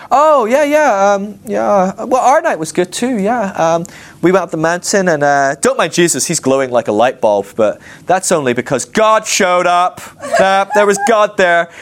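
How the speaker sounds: high-pitched, smug mock-casual bragging; swaggering boast. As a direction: brag while pretending it is no big deal. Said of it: "they have a swagger".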